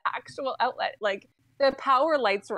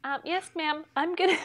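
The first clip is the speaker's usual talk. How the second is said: high, meek agent voice; imitated phone girl; 5 semitones higher than usual. Girl answering the cursing customer, high compliant imitation. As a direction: mimic a compliant agent, pitched up. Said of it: "this is me to this girl".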